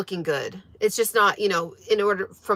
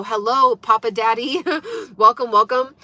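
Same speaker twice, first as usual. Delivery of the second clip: usual speaking voice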